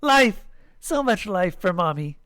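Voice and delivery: high burst, then settling; gleeful little creature; proud. A narrator's reading. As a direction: cheer it like a pleased child. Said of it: "said proudly".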